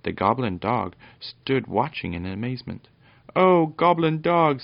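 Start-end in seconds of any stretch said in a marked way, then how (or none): 3.4-4.6 high, loud, drawn-out reproach; reproachful. A narrator's reading. Fenella scolds her goblin dog, reproachful. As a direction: scold him with a long exclamation. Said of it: "said Fenella reproachfully".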